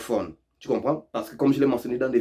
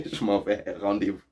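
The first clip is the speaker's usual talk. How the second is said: ordinary talk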